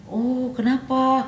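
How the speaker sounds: slow, high "Oh, kenapa?" put to the friend; drawn-out surprised question. Retold or imitated. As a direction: ask it slowly, high and curious. Retold dialogue, friend's reply. (tanya pelan dan tinggi, penasaran)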